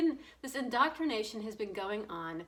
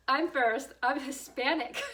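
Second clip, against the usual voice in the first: louder, higher mock entitled claim; 4 semitones higher, 7 dB louder than usual. Cutting the vaccine line, mock entitled and louder. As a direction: claim first place, hammed up. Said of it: "could you see me showing up".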